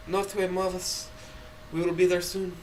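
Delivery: light high reassuring chirp, kept soft; chirpy Rosa. Acted. Rosa reassures from the driver's seat, chirpy and soft. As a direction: cheerfully soothe the worried passenger. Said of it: "Rosa chirps".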